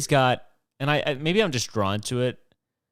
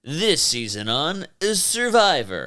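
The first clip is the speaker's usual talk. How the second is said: announcer voice; 6 semitones higher, 4 dB louder, slower than usual. Retold or imitated. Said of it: "and then it just starts".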